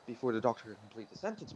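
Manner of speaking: ordinary delivery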